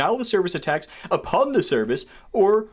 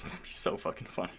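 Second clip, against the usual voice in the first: breathy laughing whisper, much softer; whispered aside; 14 dB quieter than usual. Real, not acted. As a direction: giggle it out under the breath.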